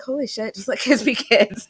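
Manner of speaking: high, exasperated "holy shit"; exasperated outburst. Retold or imitated. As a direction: blurt it out in disbelief. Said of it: "I'm just like".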